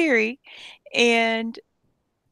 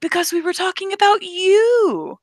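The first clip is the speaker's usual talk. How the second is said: much higher, eerie sing-out swelling on "you"; octave jump; 11 semitones higher, 3 dB louder than usual. Retold or imitated. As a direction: imitate an assistant's voice suddenly leaping up. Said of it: "And his octave changed".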